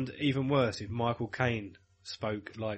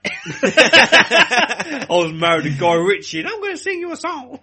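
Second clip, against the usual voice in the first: loud, slow, squeaky-high American-English parody; mock American-English star, loud and cracking up; 9 semitones higher, 10 dB louder, much slower than usual. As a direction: ham up a silly American-English accent, climbing much higher. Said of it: "she's got a weird American English accent".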